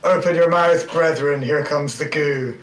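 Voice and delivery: in-character shout. Acted. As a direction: call out to a comrade in battle, easing as it goes. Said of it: "I shout to Gahag Balachis".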